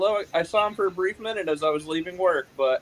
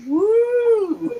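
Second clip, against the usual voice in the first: party whoop. Acted. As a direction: whoop like a wild night out. Hyping a Friday night bar.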